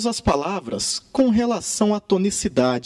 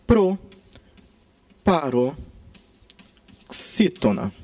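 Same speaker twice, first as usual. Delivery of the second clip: PROPAROXÍTONA drawn out very slowly, with long pauses inside; broken into chunks; 2 semitones lower, much slower than usual. Shown as a demonstration. Teacher naming the antepenultimate-stress class, proparoxítona slowly broken into chunks. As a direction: say "proparoxítona" piece by piece, pausing between parts.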